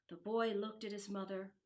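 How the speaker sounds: usual speaking voice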